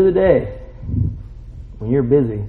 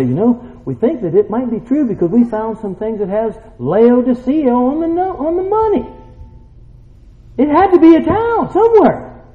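high-pitched excited concession, building louder; excited scientists; 9 semitones higher, 4 dB louder than usual. Acted. Scientists admitting a biblical find, mock excitement. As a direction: climb high as the admission builds.

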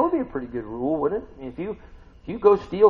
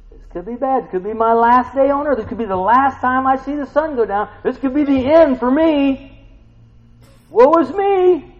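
hammed-up wail, climbing high and loud; mock despair; 12 semitones higher, 10 dB louder than usual. Preacher acting Daniel's panic in the den, mock despair.

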